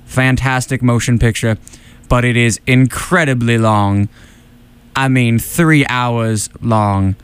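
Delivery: silky-smooth, even narrator; measured film narration, stretching the last phrase; Morgan Freeman. Acted. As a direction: glide through it with little pitch movement. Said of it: "keep my voice as silky smooth as possible".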